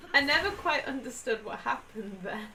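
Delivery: ordinary delivery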